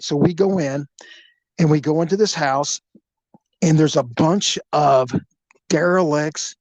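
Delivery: usual speaking voice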